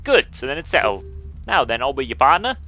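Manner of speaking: put-on brisk deal-sealing English accent. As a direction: settle it cheekily in a mock English accent. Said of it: "I wanna do the English accent".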